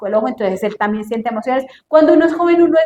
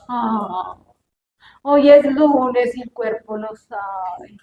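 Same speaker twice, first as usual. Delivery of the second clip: very slow, dragging sigh of a line; Monday weariness; 3 semitones lower, much slower than usual. Acted. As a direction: groan it out like Monday morning. Lunes sin ganas. (lamento lento de lunes cansado)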